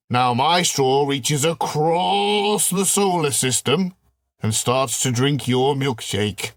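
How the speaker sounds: drawn-out, relishing villain; slow villain monologue. Acted. Alien taunting the general about the straw, slow and relishing. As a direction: spell out the threat slowly.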